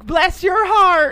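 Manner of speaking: hammed-up Southern lady accent. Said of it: "talking with a southern accent".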